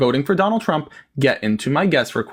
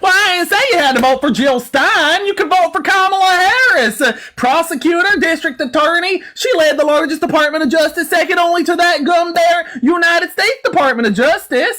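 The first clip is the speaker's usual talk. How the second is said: high, twangy yokel put-on; exaggerated Southern drawl, pitched up; hillbilly interrogator; 13 semitones higher, 5 dB louder than usual. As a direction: grill them like an exasperated country bumpkin. Mock hillbilly host argues.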